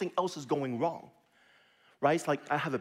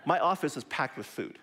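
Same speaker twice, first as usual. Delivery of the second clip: normal talking voice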